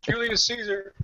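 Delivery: silly high quiz answer. Pretend.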